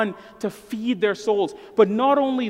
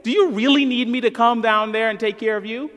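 mock yell downstairs; 3 semitones higher, 5 dB louder, faster than usual. Dad yelling down to his crying four-year-old, mock impatient. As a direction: call down the stairs, impatient and put out. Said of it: "I never yell out".

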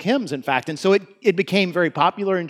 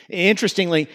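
ordinary delivery

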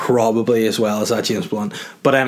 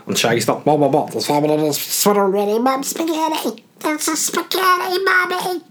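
high, strained, choked rapping; strangled voice; 13 semitones higher than usual. Retold or imitated. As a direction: squeeze the rap out like you're choking. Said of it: "It sounds like you're getting strangled".

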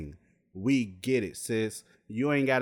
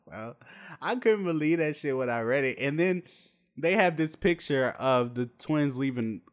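ordinary delivery

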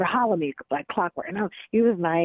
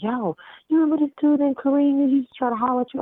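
high, louder-than-chat quote of an eager friend; retold excited call; 8 semitones higher, 4 dB louder than usual. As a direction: imitate a pal calling with gossip. Old teammate's excited phone call, retold.

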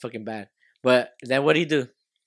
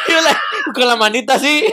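laughing; 10 semitones higher, 5 dB louder than usual. Real, not acted.

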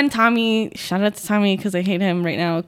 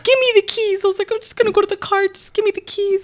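high, whining plea for the keys; high whimpering demand, close to tears; whiny drunk pleading; 11 semitones higher, faster than usual. Retold or imitated. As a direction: beg like an upset drunk kid. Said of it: "So I was like".

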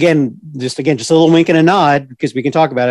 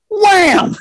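mock shout. Fan telling a friend about a movie punch, mock shout. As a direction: belt out Wham! like a sudden movie punch.

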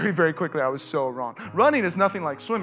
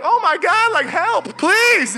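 high, loud pleading yell for help; pleading shout; 13 semitones higher, 9 dB louder, much slower than usual. Retold or imitated. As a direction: beg the boat to notice you, loud and high. Calling to boat for help.

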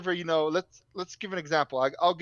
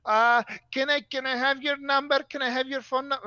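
higher, hesitant mock receptionist; imitating the receptionist; 5 semitones higher than usual. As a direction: play her as a clueless clerk. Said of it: "She goes".

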